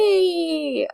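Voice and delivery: high indignant yelp; mock offended. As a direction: pretend outrage. Called a fart smeller.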